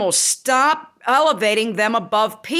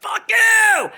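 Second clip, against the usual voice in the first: high retold yell; drawn-out, high mock-furious shout; flipping out; 10 semitones higher, 3 dB louder than usual. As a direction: reenact screaming at the dog, pitched way up. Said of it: "I was flipping out".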